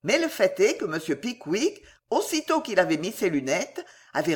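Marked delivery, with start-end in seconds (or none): none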